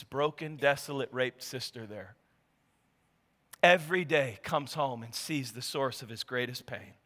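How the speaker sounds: low, soft, somber storytelling; hushed preaching. A narrator's reading. Preacher tells of the brother seeing his broken sister, hushed. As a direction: drop down low and let the sorrow sit.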